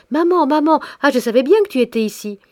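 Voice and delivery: put-on a ten-year-old bursting in, shouting; little girl shouting happily to her mother; piping child's shout. A narrator's reading. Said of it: "en criant de sa voix flûtée".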